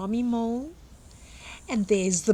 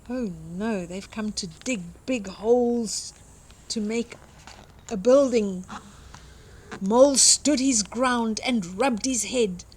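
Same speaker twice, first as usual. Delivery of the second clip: ordinary delivery, nothing marked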